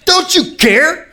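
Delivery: high-pitched, hammed-up indignant accusation; accusing Martha. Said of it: "Martha's beating him up".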